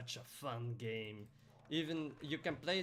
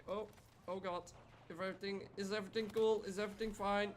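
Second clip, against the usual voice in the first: worried panic; 7 semitones higher, faster than usual. Retold or imitated. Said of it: "I'm just like".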